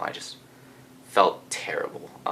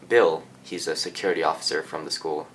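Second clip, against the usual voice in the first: ordinary talk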